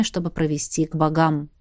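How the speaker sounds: ordinary delivery, nothing marked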